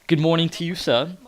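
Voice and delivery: high, loud, indignant mock-British; proper British accent. Gamer recreating his friend's heated message, indignant British accent. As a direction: put on a stuffy, affronted British accent. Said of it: "just proper English, British".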